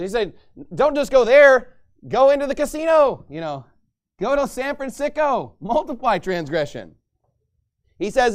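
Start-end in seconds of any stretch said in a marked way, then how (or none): 0.7-6.9 loud, high sarcastic egging on; mocking. God mocking the sinners toward the casino, loud taunt. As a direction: taunt them to go sin. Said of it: "God is mocking these sinners".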